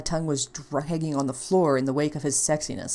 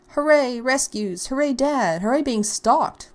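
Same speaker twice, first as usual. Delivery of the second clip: higher, louder hooray chant; mock cheering; 7 semitones higher, 4 dB louder, slower than usual. [name] celebrating her rescue, cheering. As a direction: cheer with sarcastic glee.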